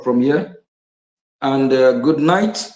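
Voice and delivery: usual speaking voice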